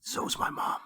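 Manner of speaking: breathy unvoiced joke, sly; whispered aside. Real, not acted. Joking about his mom, a sly whispered aside. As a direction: whisper it as a cheeky secret.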